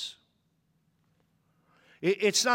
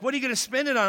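higher, curious mother's question; a touch of a mom asking sweetly; lightly voiced mom; 7 semitones higher, much faster than usual.

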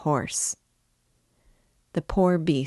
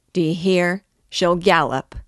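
louder, emphatic jeer; jeering call; 2 semitones higher, 5 dB louder than usual. A narrator's reading. Laughing crowd passes on the boast, calling out. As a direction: call it out mockingly.

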